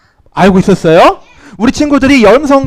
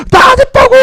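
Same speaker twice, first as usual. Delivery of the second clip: high protesting yell; acted aggrieved shout; 4 dB louder than usual. Teacher playing a wrongly blamed child, high indignant shout. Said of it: "소리를 지르기도 해요".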